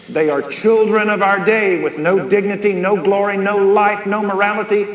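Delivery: fervent preaching. Real, not acted. Preacher hammering his list.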